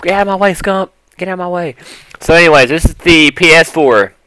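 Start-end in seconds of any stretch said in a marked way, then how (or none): 0.0-1.8 higher-pitched mock brush-off; lightly voiced pushy gamer; Nadeshot. Nadeshot shoving his rival Scump aside, mock pushy voice. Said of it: "Nadeshot is like".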